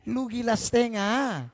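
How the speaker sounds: lightly scornful slow put-down; mocking retort. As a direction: scoff at him as half mad. Mocking the man's reasoning.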